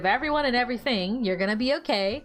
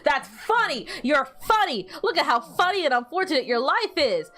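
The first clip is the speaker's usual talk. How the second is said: mocking laughter; 6 semitones higher than usual. Mocking the sick follower.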